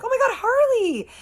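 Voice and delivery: very high, loud squealed greeting; excited squeal. Retold or imitated. Danielle turns and sees Harley, excited squeal. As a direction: imitate a thrilled friend squealing hello.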